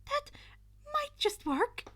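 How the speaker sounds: high, loud eager; light up at the idea; excited. Acted. Izuku excited realization.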